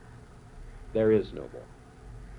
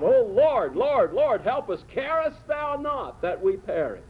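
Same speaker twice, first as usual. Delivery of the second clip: frightened cry for help; 8 semitones higher, slower than usual. Retold or imitated. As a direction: cry out like men sinking in a storm. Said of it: "and they cry out".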